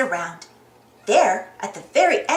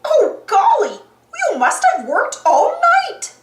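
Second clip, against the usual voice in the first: high, loud, swooping gush; drawn-out cartoon astonishment; amazed Clarabelle; 9 semitones higher, 6 dB louder, slower than usual. A narrator's reading. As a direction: gush loudly with stretched words.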